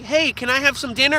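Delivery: high-pitched innocent animal asking; innocent pet voice. Acted. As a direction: ask like a trusting pet.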